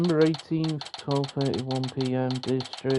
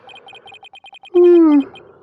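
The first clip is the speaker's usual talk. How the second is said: high anguished wail; 16 dB louder than usual. Acted. Villain's breakdown cry.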